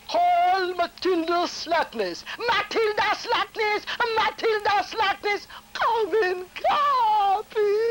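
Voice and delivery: high, slow judge's call; Jamaican court judge. Retold or imitated. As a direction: summon the witness like a court crier. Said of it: "I started with this judge".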